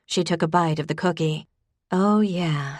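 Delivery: usual speaking voice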